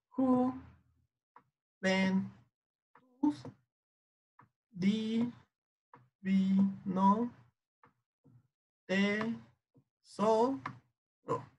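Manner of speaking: syllable by syllable with long pauses; measured, separated syllables, each left hanging; sílaba por sílaba. Shown as a demonstration. Dividing the verse into syllables. (separando cada sílaba, despacio)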